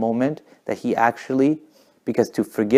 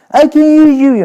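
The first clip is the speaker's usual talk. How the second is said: high, very loud scream; 11 dB louder than usual. Retold or imitated. Singer answering a heckling crowd, screaming. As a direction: imitate a rock singer screaming back. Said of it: "start his guitar and start screaming".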